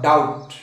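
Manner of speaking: डाउट said as dout, silent b, for the class to repeat; model pronunciation. Shown as a demonstration. Teacher has students repeat after him, silent b. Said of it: "उच्चारण बोलिए मेरे साथ".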